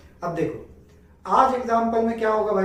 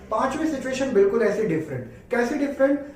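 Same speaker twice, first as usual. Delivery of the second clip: excited build-up; 5 semitones higher, 3 dB louder than usual. Acted. Teacher builds up to fifth case.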